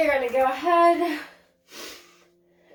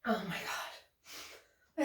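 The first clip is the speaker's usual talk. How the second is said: exhausted sigh; 6 semitones lower, 11 dB quieter, much slower than usual. Real, not acted. Exhausted after yoga set.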